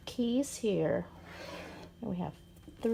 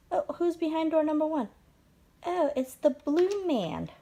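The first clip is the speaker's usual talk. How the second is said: put-on talking to a toddler; 5 semitones higher than usual. Revealing toy figure to toddler.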